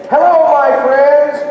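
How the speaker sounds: hello boomed high and loud, slowed; translating the greeting. Shown as a demonstration. Teacher giving the crowd the meaning, loud and slow. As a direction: announce it like a greeting. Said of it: "what you're doing is saying".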